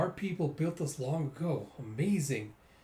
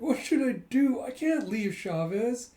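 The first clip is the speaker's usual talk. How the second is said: high, loud anguished question; acted dismay; 9 semitones higher, 4 dB louder than usual. Koyomi told she can't take the dog, loud mock dismay. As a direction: play it distraught, voice raised.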